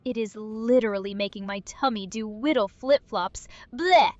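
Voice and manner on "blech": loud gagging noise; disgusted blech. Acted. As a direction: fake a squeamish retch. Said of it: "easily disgusted Cringler".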